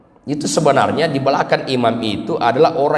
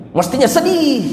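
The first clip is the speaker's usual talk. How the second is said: mock sincerity; 11 semitones higher than usual. Mock answer about grief.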